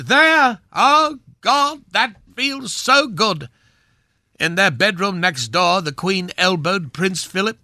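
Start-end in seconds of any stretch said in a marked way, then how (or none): 0.0-3.5 mock exclamation. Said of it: "Camilla exclaimed".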